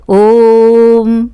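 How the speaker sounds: chanted long ओम; prolonged vowel. Shown as a demonstration. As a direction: intone ओम, stretching the vowel. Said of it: "तो ओम जो बोला".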